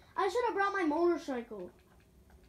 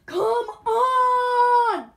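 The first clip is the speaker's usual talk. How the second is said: high loud frustrated cry; mock rage shout; 9 semitones higher, 14 dB louder than usual. Vlogger fake-raging at the climb, shouting. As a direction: yell it out in pretend despair. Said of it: "pretending like I am".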